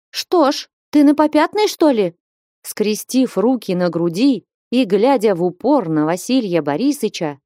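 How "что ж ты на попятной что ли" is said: high, challenging exclamation; exclaiming. A narrator's reading. Флёнушка challenges the backing-out suitor, exclaiming. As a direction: confront him in a raised pitch. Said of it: "вскликнула Флёнушка".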